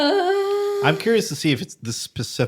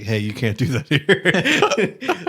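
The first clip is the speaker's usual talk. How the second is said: laughing; 3 semitones higher, much slower than usual. Retold or imitated. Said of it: "has to go and say".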